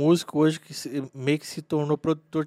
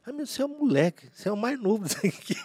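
quick, high voice of the bandmate; animated retold quote; 7 semitones higher, much faster than usual. Sax player telling the youngest he's just a kid, animated imitation. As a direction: rattle it off high, amused imitation. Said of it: "Ele falou assim".

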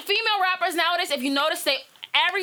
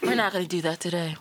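much lower, smooth imitation; imitating sexy voice; 11 semitones lower than usual. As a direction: drop low and croon it. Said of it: "he does have, like, the".

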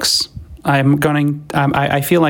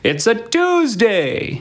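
sing-song date announcement; 11 semitones higher, slower than usual. Acted. Drawn-out date at show opening.

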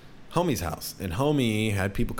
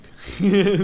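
loud bursting laugh; real loud laugh; 11 semitones higher, 8 dB louder than usual. Real, not acted. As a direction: crack up at yourself. Laughs at own joke.

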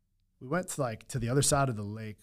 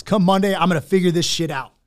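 much higher and louder, cocky boast; drunken bravado; 7 semitones higher, 12 dB louder than usual. Retold or imitated. Tipsy man at taco shop promising himself, loud cocky bravado. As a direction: crow it like nothing can stop you. Drunk self-quote, feeling invincible.

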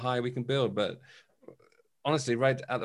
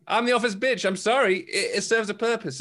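excited; 7 semitones higher, 4 dB louder than usual. Real, not acted. Gleeful confession of loving gossip.

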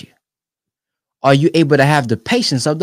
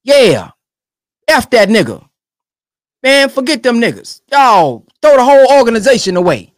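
high, louder scornful rant; imitating a hothead; mock angry dismissal; 7 semitones higher, 5 dB louder than usual. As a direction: blow them off in mock anger. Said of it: "we just quick to".

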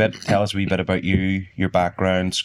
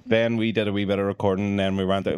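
ordinary delivery

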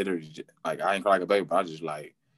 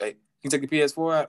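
fast security demand; 7 semitones higher, faster than usual. Retold or imitated. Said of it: "Whoop, whoop".

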